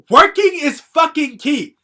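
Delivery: high, loud, every word hammered slowly; furious slow shout. Real, not acted. Telling the do-nothing crew that work matters, slow and yelling. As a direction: yell it like the whole point of the rant. Ranting at friends who never work.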